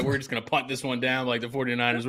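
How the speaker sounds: usual speaking voice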